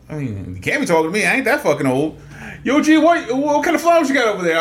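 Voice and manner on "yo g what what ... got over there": put-on kids calling out. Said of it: "I was like".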